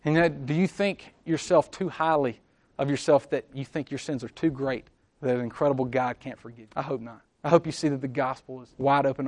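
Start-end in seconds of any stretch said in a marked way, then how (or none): none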